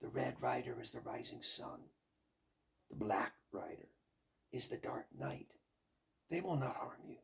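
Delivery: hushed whispered answer. Acted. Baba Yaga whispering to Vasilisa. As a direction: breathe the words as a secret.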